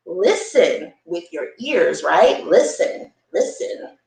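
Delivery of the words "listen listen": ordinary delivery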